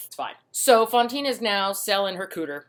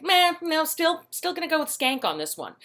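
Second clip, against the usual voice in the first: high, whiny group brush-off; hammed-up sneer in a put-on high voice; mock dismissive women; 10 semitones higher than usual. Factory women judging an unmarried mother, mock dismissive.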